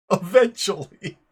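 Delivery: loud, bursting into laughter on eventually; eventually blurted through a laugh; cracking up while saying eventually. Real, not acted. Laughs mid-word, then repeats it.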